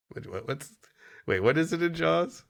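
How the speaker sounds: ordinary talk, nothing marked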